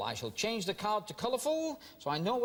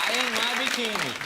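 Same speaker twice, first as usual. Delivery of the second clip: mock camp; 4 semitones higher, 7 dB louder, faster than usual. Host jokes about Bermuda holiday.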